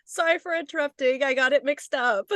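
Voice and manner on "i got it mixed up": ordinary talk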